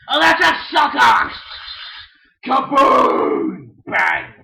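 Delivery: high, loud excited yell; kid's play-battle cheer; battle cry. Pretend. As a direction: shout it like a blast went off. Toy battle explosion.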